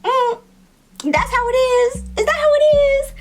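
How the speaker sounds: very high sassy taunt; high teasing. Retold or imitated. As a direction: tease them playfully high.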